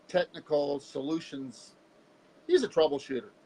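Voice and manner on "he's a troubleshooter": ordinary talk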